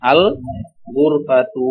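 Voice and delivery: ordinary talk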